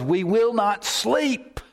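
high, drawn-out emphatic declaration; emphatic vow. Real, not acted. Preacher vowing ministry for the church, emphatic. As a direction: declare it slowly, pitched right up.

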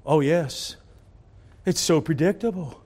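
ordinary talk, nothing marked

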